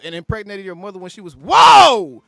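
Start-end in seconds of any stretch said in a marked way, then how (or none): 1.4-2.2 a much louder, higher whoa; shouted whoa. Real, not acted. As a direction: yell it, totally shocked. Reacting to a clip.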